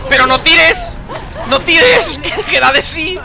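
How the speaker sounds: high, loud plea to stop; alarmed shouting. Real, not acted. Player yelling at characters pulling someone, alarmed shout. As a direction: yell at them to stop.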